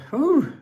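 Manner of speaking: sharp, high whew blowing out the chili burn. Real, not acted.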